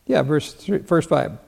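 ordinary delivery

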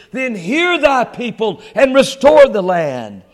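loud, high, forceful sermon delivery; fervent preaching. Acted. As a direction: preach it out at full force, building.

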